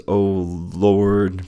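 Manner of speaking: usual speaking voice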